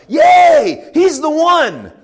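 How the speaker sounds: loud high cheer of a crowd. Retold or imitated. A preacher voicing the excited crowd, shouting high. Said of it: "people are like".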